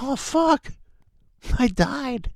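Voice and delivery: high, put-on voice for a goofy in-game death; mocking himself getting killed by a shotgun; mock dying player. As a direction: squeak it up high like a video game character who just got shot.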